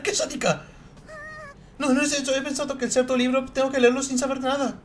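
put-on high, loud, proud girl's voice; haughty schoolgirl. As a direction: play a vain writer showing off to her friend. Voices girl characters bickering. (escritora engreída, voz aguda y fuerte)

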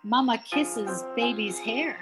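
plain reading voice

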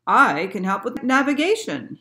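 lightly voiced ship captain; ship's captain. A narrator's reading. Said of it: "he said".

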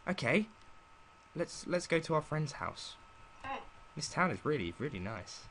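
normal talking voice

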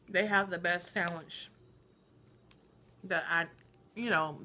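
talking with mouth full; muffled words while chewing; chewing a chip. Real, not acted. Seen 0: Bites chip near 1.7s, mouth working until ~5.8s; later just holds it.

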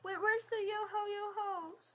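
high excited question. Real, not acted. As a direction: ask it high and eager. Excited waiting for song.

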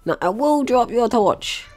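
normal talking voice

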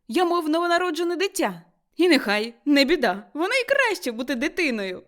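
lively carefree delight; joyful exclamations. A narrator's reading. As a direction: happily brush every worry aside. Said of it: "Його радісні вигуки".

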